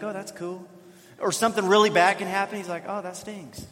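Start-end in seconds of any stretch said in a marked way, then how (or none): 0.0-0.7 quiet, understated imitation of an unbothered son; even keel. Dad imitates his other son's reaction to something amazing, even keel. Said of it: "he's just kinda even keel". 2.9-3.7 softly imitated unfazed son; even keel. Dad imitates his other son's reaction to something bad, even keel. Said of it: "he's just kinda even keel".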